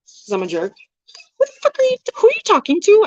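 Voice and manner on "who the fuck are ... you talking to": high-pitched offended retort; retold indignant shriek, way up high; mock outrage. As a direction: squeal it like she can't believe the nerve. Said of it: "I didn't say who the fuck".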